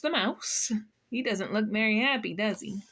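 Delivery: ordinary delivery, nothing marked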